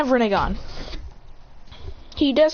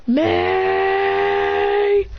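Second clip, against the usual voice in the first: high, sustained battle cry; drawn-out attack chant; 10 semitones higher than usual. Acted. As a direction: stretch the syllable long and high. Held attack syllable.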